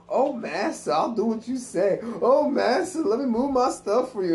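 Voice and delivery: hammed-up servile plea; mock servile voice. Kids mocking servile voice.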